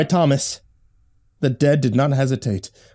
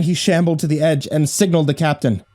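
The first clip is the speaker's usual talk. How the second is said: plain reading voice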